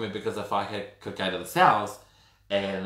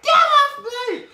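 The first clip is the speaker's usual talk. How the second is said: very high, loud, drawn-out scream; shriek; 13 dB louder, slower than usual. Retold or imitated. Imitating the crazy lady fighting off the officers, screaming. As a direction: screech it while struggling. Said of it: "and she's like".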